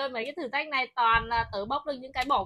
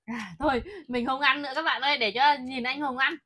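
ordinary talk, nothing marked

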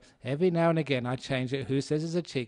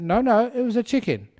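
high, loud insistent contradiction; mock quarrelling wife; 8 semitones higher, 8 dB louder than usual. Wife refusing to give in to her husband, mock heated insistence. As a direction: argue back higher and louder.

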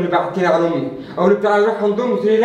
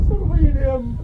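higher, pleading with a crack; tearful plea; 5 semitones higher than usual. Acted. Son at his mother's grave, tearful plea. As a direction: beg her to forgive you. Begging dead mother's forgiveness.

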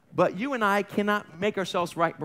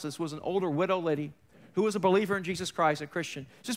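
normal talking voice

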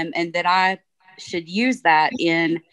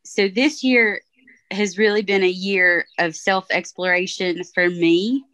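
usual speaking voice

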